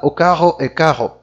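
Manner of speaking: imitated French accent with "caro" said like "carro"; Portuguese in a French accent, both Rs in the throat; French-accented "caro" with a guttural R. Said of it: "Ele vai falar".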